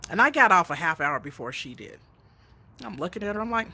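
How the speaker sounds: usual speaking voice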